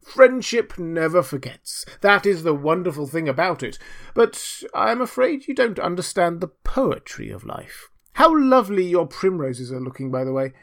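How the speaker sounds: pompous miller. Acted. Miller lecturing Hans on friendship, pompous. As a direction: declare it with self-satisfied grandeur.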